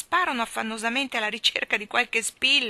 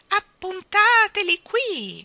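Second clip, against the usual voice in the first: very high, loud, slow squawk; shrill old lady cry; 12 semitones higher, 9 dB louder, much slower than usual. Acted. Miss Calico calling to the children, very high loud slow. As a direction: shriek the order like a hen. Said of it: "gridò la signorina Calicò".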